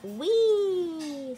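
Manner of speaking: a high, loud gleeful "wee"; excited whoop. Acted. Letter racing up the tree, gleeful high whoop. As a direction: squeal it like a kid racing ahead.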